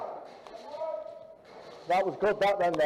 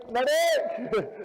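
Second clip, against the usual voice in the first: loud, higher call for help; man down shout; 9 semitones higher, 4 dB louder than usual. Real, not acted. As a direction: yell for a medic. Hit, calling for medic.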